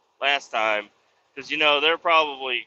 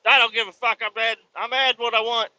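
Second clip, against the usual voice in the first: higher, louder mock defiance; defiant; 9 semitones higher, 3 dB louder than usual. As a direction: throw it out like you don't care. Acting out own defiant remark.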